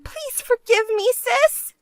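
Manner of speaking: high-pitched, desperate apology; tearful pleading sister. Acted. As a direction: beg forgiveness as if close to crying. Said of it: "you'd come crying to me like that".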